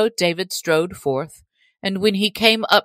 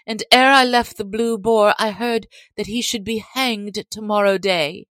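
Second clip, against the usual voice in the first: higher, anxious voice of a lass; lass crying out; 6 semitones higher than usual. A narrator's reading. Lass telling Robin of Will's capture, higher-pitched worried cry. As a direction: report bad news in a raised, girlish pitch. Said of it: "cried she".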